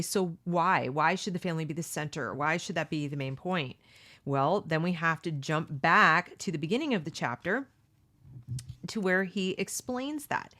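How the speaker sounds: ordinary talk, nothing marked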